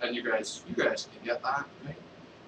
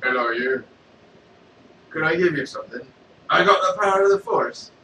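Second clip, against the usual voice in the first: a hint of Darth Vader, louder; lightly voiced Vader, louder and proud; 2 semitones higher, 8 dB louder than usual. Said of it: "went to a guy in the street and was like".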